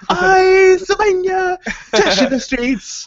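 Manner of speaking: hammed-up African accent, very high and loud; mock African accent. Teller mocking his own failed impression, in exaggerated African accent. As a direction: belt out a silly fake African accent, drawn out. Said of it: "doing, like, an African thing".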